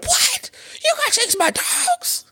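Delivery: squealed high, far-off shocked voice; mock amazement. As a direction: shriek it like a gasping fan. Mocking amazed listeners.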